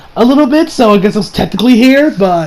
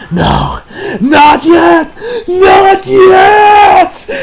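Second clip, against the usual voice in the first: desperate shout; 11 semitones higher, 3 dB louder, much slower than usual. Retold or imitated. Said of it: "he's like".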